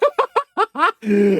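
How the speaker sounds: high-pitched burst of laughter; high cackling laugh; incredulous laugh. Real, not acted. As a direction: crack up in disbelief. Laughs at the absurd comment.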